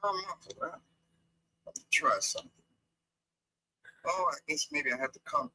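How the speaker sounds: usual speaking voice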